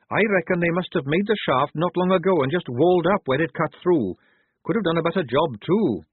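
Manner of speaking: high, matter-of-fact appraisal; Tonka's voice. A narrator's reading. Tonka assesses the masonry.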